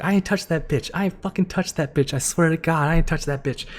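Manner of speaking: high, loud, retold protest; frantic inner denial. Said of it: "in my mind, I'm like".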